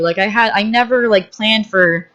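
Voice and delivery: normal talking voice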